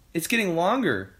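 put-on high, lively boy's voice; young George. A narrator's reading. George answering about the neck, high boyish voice. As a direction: report it with surprise, falling at the end. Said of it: "George said".

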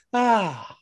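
high blissful sigh of relief; imagined relieved ah. Pretend. Man imagining coming home to AC, high relieved ah. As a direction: sigh like walking into cool air. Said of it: "excited to walk into my home".

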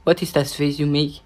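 ordinary delivery

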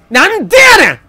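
very high, loud yell of protest; loud Kansai protest yell; outraged shout. Real, not acted. Sudden game frustration.

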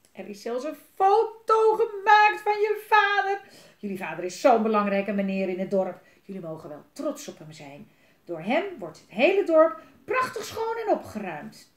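put-on mother bragging to the kids about their father; enthusiastic mother. A narrator's reading. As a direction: gush about the important dad. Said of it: "die moeder is enthousiast".

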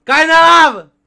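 high loud shriek; scream. Real, not acted. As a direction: scream as you fall. Player falls in lava.

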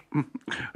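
short high soft chuckle; a little pleased laugh; professor's chuckle. Acted. Professor laughs at his plan.

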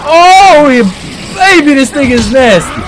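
loud, high excited cry; thrilled by the hit; excited exclaiming. Real, not acted. Excited about weapon.

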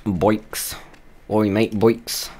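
"Boiks" drawn out in a lightly put-on cockney accent; mock cockney accent. Suggesting bikes to his friend in mock cockney. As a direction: call it out like a cockney mate. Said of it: "force the British".

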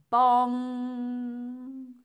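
loud, high bong with a long ringing hum; sung-out bell strike, held on the ng; ringing bell imitation. A narrator's reading. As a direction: strike it hard and let it ring.

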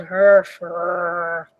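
low grumbling bear growl; muttered growl. A narrator's reading. Old Bear growling the blessing, low. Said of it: "muttered and growled".

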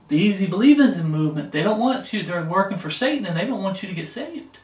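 usual speaking voice

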